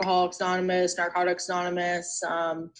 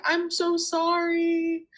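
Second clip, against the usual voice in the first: retold crying; 10 semitones higher, much slower than usual. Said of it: "And I just started bawling, crying".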